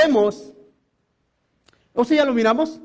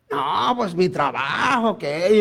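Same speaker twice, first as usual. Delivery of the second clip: evasive worker; 6 semitones lower, much slower than usual. Retold or imitated. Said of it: "me empezó a echar un rollo".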